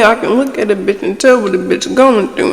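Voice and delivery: ordinary talk, nothing marked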